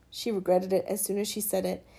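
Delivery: ordinary delivery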